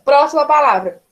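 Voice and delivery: next word call. Real, not acted.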